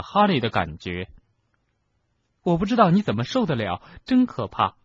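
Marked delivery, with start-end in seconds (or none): 2.4-4.7 candid remark in a higher voice; frank. A narrator's reading. Susan Bones tells Harry how awful it is, frankly. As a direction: admit it openly, pitched up. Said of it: "他坦率地说".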